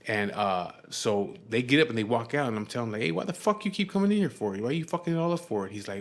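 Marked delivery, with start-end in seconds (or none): 3.0-5.7 higher-pitched retold scolding; mildly indignant, pitched up; mock exasperated. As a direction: act out an exasperated challenge to a friend. Teller scolds the friend, retold.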